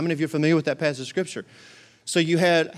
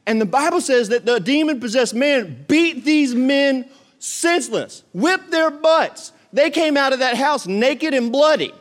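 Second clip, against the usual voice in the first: preaching at full force; 7 semitones higher than usual. Acted. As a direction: preach it out to the congregation. Preaching about the beaten sons.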